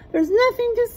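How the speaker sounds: put-on high, light girl voice; high childish shrug; little sister voice. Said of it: "she said with a shrug".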